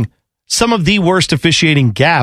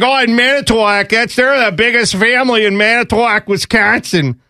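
put-on higher folksy Wisconsin accent; 7 semitones higher than usual. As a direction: do a Making a Murderer Wisconsin local accent. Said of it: "everybody there sounds like they were also on Making a Murderer".

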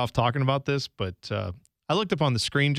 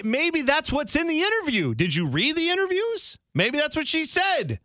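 mock indignant; 12 semitones higher than usual. Real, not acted. Mocking banter, pitched up.

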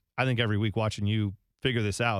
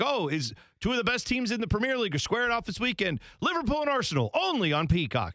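mock hype; 8 semitones higher than usual. Parody of broadcast scheduling pitch.

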